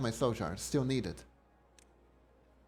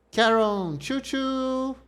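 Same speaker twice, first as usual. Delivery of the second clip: high, loud choo-choo pun; playful train call; 11 semitones higher, 6 dB louder, much slower than usual. Pretend. Punning on the name like a train.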